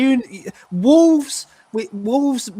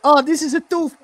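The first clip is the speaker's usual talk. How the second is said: higher-pitched mock Italian accent; 7 semitones higher than usual. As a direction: put on a light Italian accent. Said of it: "speaking in an Italian accent".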